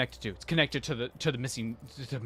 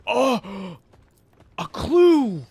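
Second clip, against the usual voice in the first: high, loud, thrilled gasp; excited gasp; 10 semitones higher, 9 dB louder, much slower than usual. Real, not acted. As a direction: gasp out a delighted discovery. Finds clue in game.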